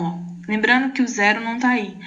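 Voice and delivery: usual speaking voice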